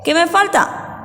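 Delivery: ordinary talk, nothing marked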